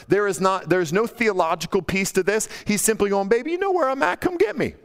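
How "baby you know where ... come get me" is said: much higher, sing-out flirty tease; playful teasing. Retold or imitated. Solomon playfully calling to his wife, teasing in a high voice. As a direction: tease her with a high-pitched, cocky come-on. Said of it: "being playful with her".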